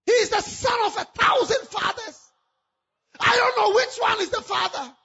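loud, high, wailing despair; anguished crying shout. Acted. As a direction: cry it out, heartbroken and loud. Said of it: "She said".

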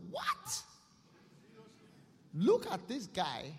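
loud indignant rich man, shocked at the beggar in heaven; rich man's outrage. Acted. Preacher voicing the rich man seeing Lazarus: loud outrage. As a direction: bark it out, offended that the poor man got in.

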